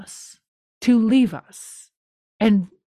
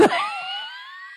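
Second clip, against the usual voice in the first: sudden squealing laugh, far higher; shrieking laugh; 5 dB quieter than usual. Real, not acted. Recapping the episode, she shrieks with laughter. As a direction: burst into a high shriek of laughter. Reacting to Brennan's face.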